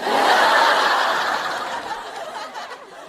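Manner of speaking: high, loud cackle; bursting with laughter; loud cackling laugh. Real, not acted. Laughing hard to her viewers at the fans.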